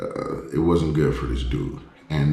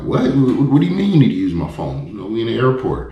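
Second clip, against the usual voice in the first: surprised; 6 semitones higher, 3 dB louder than usual. Retold or imitated. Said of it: "And I'm like".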